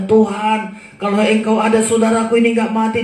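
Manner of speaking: preacher acting out a grieving woman's cry; tearful plea. Maria pleading at Tuhan's feet over her brother, imitated tearful plea. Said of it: "Dia menangis, dia menangis".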